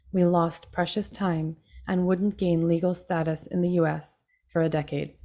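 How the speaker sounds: usual speaking voice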